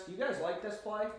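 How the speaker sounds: ordinary delivery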